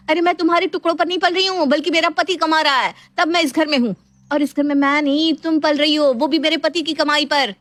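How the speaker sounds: high, insistent tirade; angry outburst. Acted. Daughter-in-law hitting back at sister-in-law's insult, angry. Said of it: "Neha का पारा high हो जाता है".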